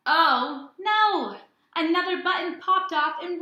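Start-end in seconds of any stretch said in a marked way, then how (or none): 0.1-1.4 higher, louder playful oh no; mock alarm. A narrator's reading. Teacher reading aloud, playful loud higher dismay. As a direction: act out mock dismay for the kids. Button lost in the story.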